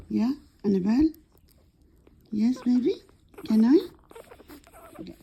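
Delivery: ordinary talk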